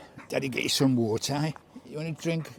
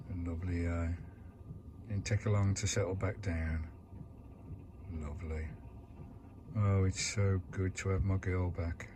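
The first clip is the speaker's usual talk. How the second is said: soft and quiet; 5 semitones lower, 9 dB quieter than usual. Real, not acted. Quiet evening narration to camera.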